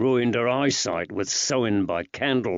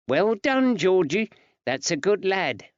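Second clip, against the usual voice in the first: woman's voice; 9 semitones higher than usual. Acted. Betsy praising the boy.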